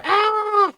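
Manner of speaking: very high yelp of glee; high squeal. Retold or imitated. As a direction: squeak it up high like a girl at the arcade. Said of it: "she was going".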